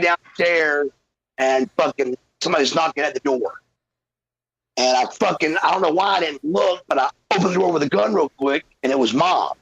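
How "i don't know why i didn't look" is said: ordinary talk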